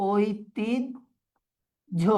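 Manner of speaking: ঐতিহ্য said very slowly, each sound held; teacher slowly pronouncing ঐতিহ্য; slow demo pronunciation. Shown as a demonstration. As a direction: model ঐতিহ্য slowly for students. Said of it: "একদম সহজ rules আগের মতো".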